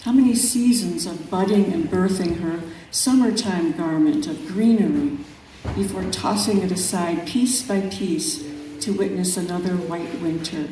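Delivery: measured, unhurried poetry reading; calm, reflective recital of a nature poem. Acted. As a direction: let each image breathe in its pauses. Reads her poem aloud.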